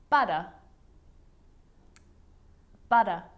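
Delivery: "butter" ending in an open uh, no R; firm, isolated "butter" as in British or Australian English; silent final R. Shown as a demonstration. Teacher showing R at the end is not pronounced, firm model. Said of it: "the R at the end of the word is not pronounced".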